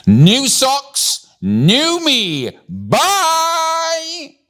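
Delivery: very high sing-out with a long held "bye"; gleeful, running off. A narrator's reading. Millie showing off new socks as she leaves, gleeful. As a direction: shout it joyfully as you dash away. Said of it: "Millie's voice faded into the distance as she ran".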